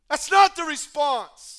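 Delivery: loud, urgent, fast-building sermon voice; full-force preaching. Acted. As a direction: preach it hard to the church.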